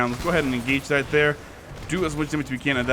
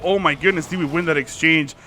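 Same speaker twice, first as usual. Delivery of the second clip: ordinary talk, nothing marked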